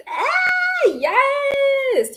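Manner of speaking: very high, drawn-out celebratory squeal; long high-pitched cheer; excited squeal. Real, not acted. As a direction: squeal with joy for a friend. Celebrates viewer's new place.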